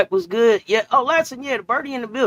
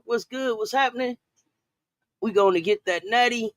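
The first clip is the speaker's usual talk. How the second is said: normal talking voice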